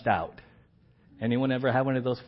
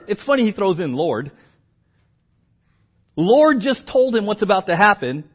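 normal talking voice

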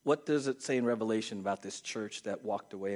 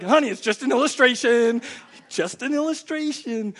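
louder, higher, laughing it off; laughing reassurance; 10 semitones higher, 10 dB louder than usual. Real, not acted. Preacher calming the ladies, laughing reassurance. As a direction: reassure them through a laugh. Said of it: "it's like".

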